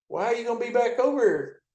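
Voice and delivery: a high, chummy call over the radio; voicing Bill.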